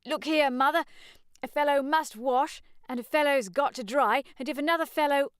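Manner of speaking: put-on high, loud young man's protest; eager defensive young man; arguing son. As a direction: argue back to his mother. Son argues with mother.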